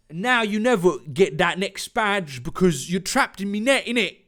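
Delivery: hammed-up London villain; London accent. Jack gloats over his trapped foes, London accent. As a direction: taunt in a broad, put-on London accent. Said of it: "just make him London".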